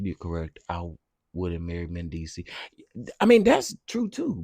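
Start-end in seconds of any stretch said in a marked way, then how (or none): 3.2-4.4 high, loud burst of agreement; excited outburst. Real, not acted. Reacting to a chat comment.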